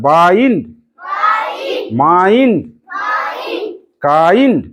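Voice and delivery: বাইন্ড, মাইন্ড, কাইন্ড each with a drawn "aa-ee"; long আই sound. Shown as a demonstration. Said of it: "আই, আই কথাটা যেমন আছে".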